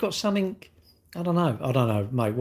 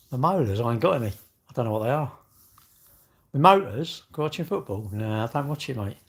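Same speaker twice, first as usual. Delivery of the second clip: ordinary talk, nothing marked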